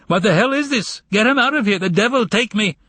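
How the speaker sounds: retold bellow, half-hearted volume; mock shout. Secretary retelling her boss's shout, mock yell. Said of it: "and he shouted".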